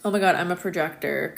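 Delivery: usual speaking voice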